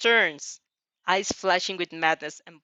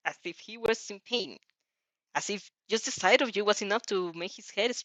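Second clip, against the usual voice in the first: usual speaking voice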